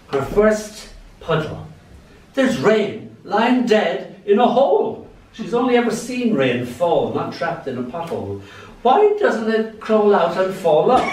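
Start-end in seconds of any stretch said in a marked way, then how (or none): 0.1-7.7 slow, measured poem reading; unhurried reading of a little poem. Acted. 8.8-11.0 put-on high, puzzled toddler question; child's wonder. Child version of the line.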